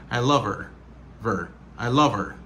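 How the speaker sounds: "love her" run together as luv-er; no H in "her", V carries over; linked, dropped H. Shown as a demonstration. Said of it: "her vin pèdi son H".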